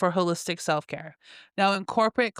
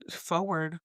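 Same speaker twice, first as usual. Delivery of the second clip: puzzled self-check; 2 semitones lower, 6 dB quieter than usual. Real, not acted. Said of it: "Girl, get it together".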